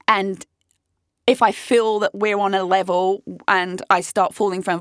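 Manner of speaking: ordinary talk, nothing marked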